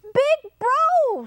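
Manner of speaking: high-pitched, dramatic frog warning; high frog exclamation; storybook frog. A narrator's reading. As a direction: announce it high as an alarmed frog. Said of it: "said Frog".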